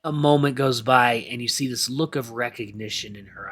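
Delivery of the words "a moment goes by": ordinary delivery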